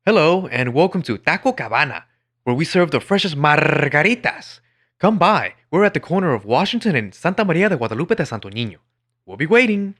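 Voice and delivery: high, loud mock commercial voice with forced Spanish names; cringy commercial Spanglish. Mocking a Taco Cabana ad, hammed-up loud high announcer. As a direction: ham up a cheesy bilingual ad announcer. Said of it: "They'll do something like".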